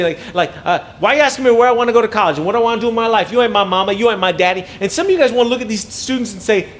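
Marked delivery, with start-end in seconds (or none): 1.0-4.6 put-on fast, loud teenage back talk; sassy student. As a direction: mouth off like a kid with attitude. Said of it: "kids have the audacity".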